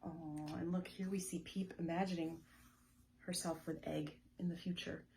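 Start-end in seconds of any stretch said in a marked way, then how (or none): none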